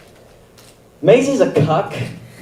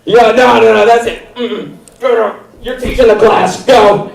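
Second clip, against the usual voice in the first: angry manic teacher; 9 semitones higher than usual. Pretend. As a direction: bark at the student like a furious teacher. Teacher ordering student up.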